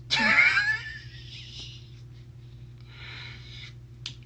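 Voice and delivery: howling high-pitched laugh. Real, not acted. Laughing at own joke.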